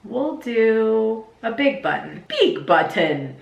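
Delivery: put-on slow childish baby talk; four-year-old voice. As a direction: talk like a little kid you babysit. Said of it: "speak like the four-year-old I nanny".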